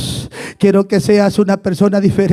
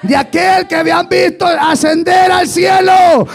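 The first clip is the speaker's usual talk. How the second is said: high, shouted preacher's cry; fervent high-pitched proclamation; full-force preaching; 10 semitones higher, 4 dB louder than usual. Real, not acted. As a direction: repeat it as a soaring shout to the congregation. Repeats the phrase at full force.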